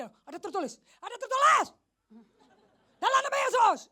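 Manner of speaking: high, loud, shouted rebuke; emotional shouting. Shown as a demonstration. Wrong way Jesus answers Satan: high, loud, shouted rebuke. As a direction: yell the scripture at the devil. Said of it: "Dia nggak ngomong gini".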